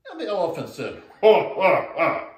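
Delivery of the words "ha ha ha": mocking laugh. Elephant laughs at frog.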